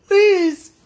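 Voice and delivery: a loud sob on "Please"; crying plea. Real, not acted. Breaks down crying to God.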